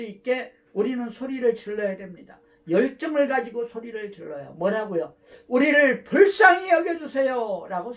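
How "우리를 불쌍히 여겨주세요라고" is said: loud cry of 불쌍히 여겨주세요; shouted plea. Shown as a demonstration. Preacher shows the congregation how to cry out, loud plea. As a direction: cry out for mercy with passion. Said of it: "소리를 질러야 되는 것이죠".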